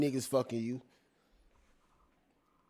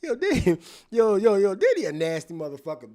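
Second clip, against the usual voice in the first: high, loud call of disbelief; real excited outcry; excited outburst; 4 semitones higher, 7 dB louder than usual. Real, not acted. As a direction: shout it out in shock. Shocked reaction to the texts.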